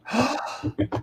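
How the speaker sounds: high, quick gasp of mock suspense; dramatic gasp. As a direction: gasp before the big reveal. Mock suspense before revealing guest.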